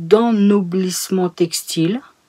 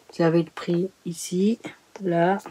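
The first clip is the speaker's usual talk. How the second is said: whispered aside; 8 dB quieter than usual. Real, not acted. Pointing out price on book.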